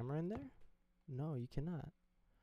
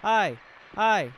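loud high yelps; panicked yelling; 7 semitones higher, 10 dB louder than usual. Real, not acted. Gamer fighting off a monster, yelling. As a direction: shout in panic mid-fight.

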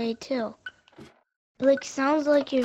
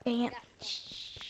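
whispering; 11 dB quieter than usual. Real, not acted. Just got killed in game.

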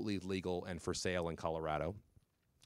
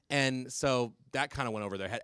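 usual speaking voice

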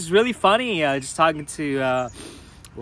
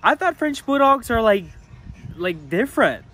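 high, loud imitation of the maid; lightly voiced surprised maid; 9 semitones higher, 3 dB louder than usual. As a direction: raise the pitch to play the puzzled maid. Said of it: "she was saying, like".

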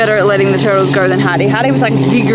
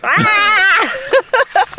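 mock turtle shriek; 11 semitones higher than usual. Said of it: "the turtles were like".

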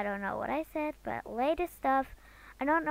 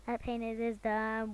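ordinary talk

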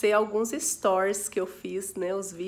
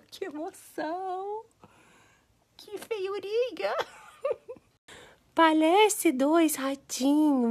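much higher, slow giggly coo; laughing tenderly; 8 semitones higher, slower than usual. Real, not acted. New grandma giggling at the newborn kittens. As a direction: giggle in delight at the tiny kittens.

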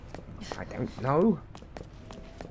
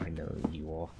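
softer, lower menacing threat; threatening; 5 semitones lower, 9 dB quieter than usual. Shown as a demonstration. Redoing the line to the game character as a soft, low menacing threat. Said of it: "I said that like it was a threat".

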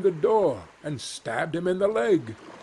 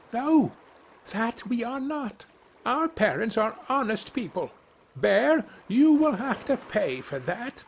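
high, shrill-pitched little birds protesting; indignant baby birds shrieking back, high; screaming young wrens; 9 semitones higher than usual. Acted. Young wrens screaming at the bear, high and outraged. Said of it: "and screamed".

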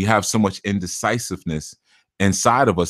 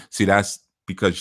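usual speaking voice

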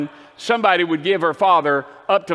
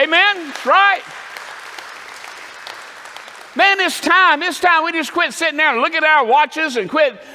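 full-force preaching; 4 semitones higher than usual. Acted. Preacher urging the congregation to give back love, shouting. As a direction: rouse the congregation, swelling to a shout.

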